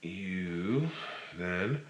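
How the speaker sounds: ordinary delivery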